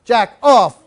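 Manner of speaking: loud commanding yell of Jack, off; retold yell. Owner yelling at her dog in a dog fight, loud commands. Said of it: "And she's screaming".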